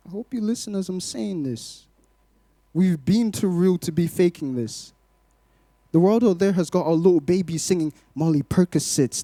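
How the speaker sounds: usual speaking voice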